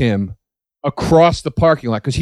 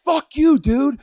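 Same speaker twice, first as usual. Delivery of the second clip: high, strained rant with the volume held in; retold angry yelling; 11 semitones higher than usual. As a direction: re-enact demanding an apology, pitched way up. Acts out his angry demand.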